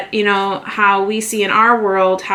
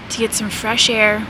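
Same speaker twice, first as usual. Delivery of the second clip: ordinary talk, nothing marked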